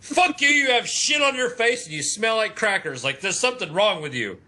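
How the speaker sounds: mock rant. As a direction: rant it like a jerk. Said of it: "He's not like".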